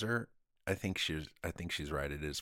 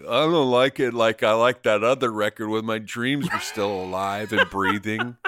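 pretentious snob; 9 dB louder than usual. Retold or imitated. Mock pretentious music snob reply.

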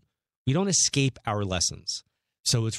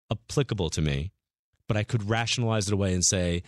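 usual speaking voice

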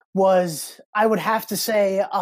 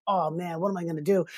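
put-on softer, lower, deflated young self; dejected kid; 3 semitones lower, 6 dB quieter than usual. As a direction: let it sink, soft and low. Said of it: "I'm like".

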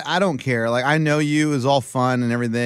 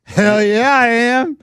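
louder, higher cocky yell; mock drunk boast; 7 semitones higher, 4 dB louder, much slower than usual. Host quoting Coach's drunken answer at the wedding, loud boast. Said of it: "you know what you said?".

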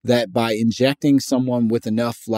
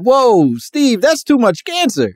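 a sudden loud, high whoa of alarm; startled lab worker; 10 semitones higher, 3 dB louder than usual. Retold or imitated. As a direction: warn Steve there's far too much cancer. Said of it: "somebody be like".

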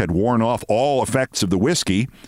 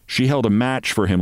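ordinary delivery

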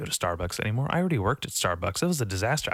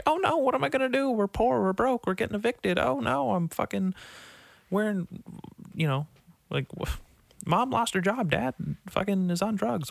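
high, fast whiny mock distress; mock panic; 11 semitones higher than usual. Imitating a younger self panicking about being poor, high and fast. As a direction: rattle off the troubles in a squeaky panic.

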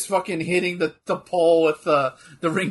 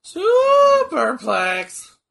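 long, high, loud whoop of a call; drawn-out shout; 12 semitones higher, 7 dB louder, much slower than usual. Real, not acted. Watching wrestling, calling out the superplex, a drawn-out shout. Echoing the wrestling move excitedly.

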